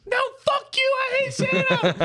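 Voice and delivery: screaming little brother, very high; mock tantrum screech, way up high; kid's tantrum. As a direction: shriek it like a furious kid. Brother's tantrum acted out in story.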